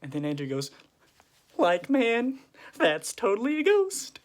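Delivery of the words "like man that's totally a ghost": high, loud comic panic; acted shriek of disbelief; freaked-out exclamation. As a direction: squeal it in hammed-up alarm. Acts Andrew spotting a ghost.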